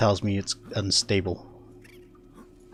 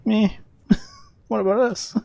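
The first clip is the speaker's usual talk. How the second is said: high, mock-plaintive meh with a laugh; overlooked Welsh; 12 semitones higher than usual. Welsh streamer jokes about being overshadowed by Irish and Scottish, mock-plaintive. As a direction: play the left-out underdog, pitched up. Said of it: "us Welsh are just kinda here like".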